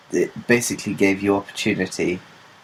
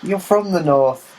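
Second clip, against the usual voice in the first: retold incredulous retort; 7 semitones higher than usual. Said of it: "I'm like".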